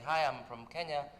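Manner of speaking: usual speaking voice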